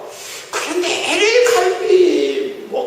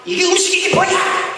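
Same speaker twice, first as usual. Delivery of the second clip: mock scolding; 9 semitones higher than usual. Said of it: "막 호통을 치고 막 인상을 쓰고".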